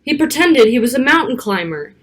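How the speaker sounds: ordinary delivery, nothing marked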